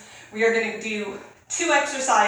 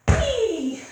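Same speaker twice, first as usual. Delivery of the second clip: playful whee; 11 semitones higher than usual. Real, not acted. Playful cartwheel-like move.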